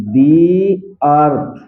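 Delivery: long "dee" for "the", then earth. Shown as a demonstration. Teacher shows students "the" before earth, said "dee". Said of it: "इस टीएचई को हम दी पढ़ेंगे".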